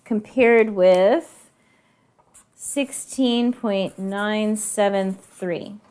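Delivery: slow, careful reading of the numbers; read out slowly. Shown as a demonstration. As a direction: spell each digit out slowly for the class. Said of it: "So they said".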